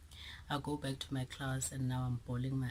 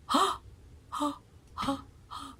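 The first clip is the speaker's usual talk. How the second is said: mock shock; 12 semitones higher than usual.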